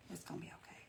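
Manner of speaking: whispered to herself, no voice; soft whisper. Real, not acted. Host whispering self-reassurance while painting nervously. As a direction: reassure yourself in a whisper, under your breath. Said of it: "But it's gonna be okay".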